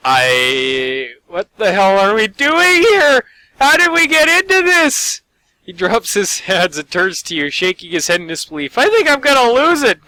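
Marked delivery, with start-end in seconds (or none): 0.1-5.2 high, loud disbelieving outburst; exasperated shout. Acted. 8.8-10.1 high, loud, near breaking; on the edge. Acted.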